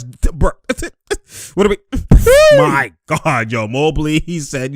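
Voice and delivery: ordinary delivery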